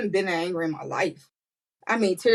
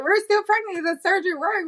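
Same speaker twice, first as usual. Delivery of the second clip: excited yell; 12 semitones higher than usual. Retold or imitated. Staff telling mom after surgery, excited yelling. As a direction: shout it with relief and joy. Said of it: "We're, like, yelling and excited".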